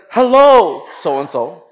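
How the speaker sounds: "Hello" sung out high and loud; loud greeting. Retold or imitated. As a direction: call out a cheerful hello over the crowd. Taps person, greets loudly.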